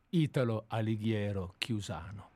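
normal talking voice

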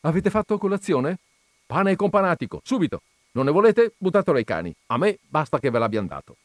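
mildly fussy attentiveness; solicitous. A narrator's reading. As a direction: ask with busy concern, then shrug it off. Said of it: "Poi domandò premuroso".

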